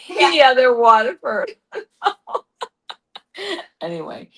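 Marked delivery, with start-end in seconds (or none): 0.0-1.8 laughing. Real, not acted. Laughing at cheap glasses.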